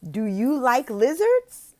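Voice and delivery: loud, slow, eager question; excited question. Shown as a demonstration. Tutor eagerly asks the child about lizards. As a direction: invite the child in. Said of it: "I'm like".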